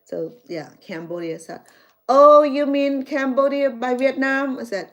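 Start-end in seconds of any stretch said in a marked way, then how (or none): 2.1-4.6 high, loud "oh" of dawning recognition; sudden realization. Retold or imitated. The visiting churchgoer suddenly realizing, high and loud. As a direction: play his lightbulb moment.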